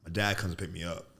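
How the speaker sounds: ordinary talk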